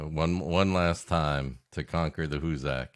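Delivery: ordinary talk, nothing marked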